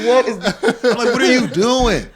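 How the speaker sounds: high, loud, laughing; cracked-up baffled question; laughing disbelief. Retold or imitated. Reacting to a guest on the podcast, laughing and baffled.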